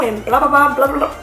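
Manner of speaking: ordinary talk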